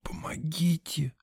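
slow, voiceless plea for help; soft, drawn-out rasped whisper; hoarse wheeze. A narrator's reading. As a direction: wheeze it out with barely any breath. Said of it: "и просипела".

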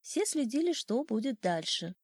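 ordinary delivery

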